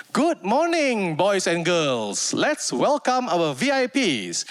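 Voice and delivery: slow, drawn-out stage-host welcome; amateur MC voice. Retold or imitated. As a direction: announce it like a nervous school MC. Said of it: "he tries his best".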